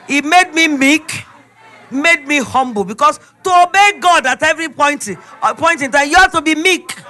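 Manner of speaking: loud, ringing sermon voice; preaching at full force. Acted. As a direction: preach it out, driving each line home.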